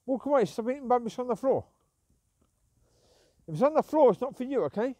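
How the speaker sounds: loud, high telling-off; scolding the dog. Real, not acted. Owner scolds her dog for eating floor rubbish, loud high telling-off.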